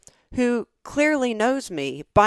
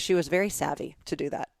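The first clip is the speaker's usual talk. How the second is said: ordinary talk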